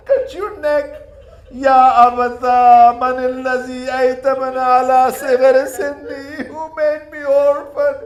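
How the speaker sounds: high, very loud, slow wail with a breaking cry; keen each Ya Abata as a sobbing child; sobbing lament. Acted. Sakina mourns her father's head, wailing and sobbing. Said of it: "She was start crying".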